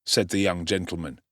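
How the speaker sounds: ordinary delivery, nothing marked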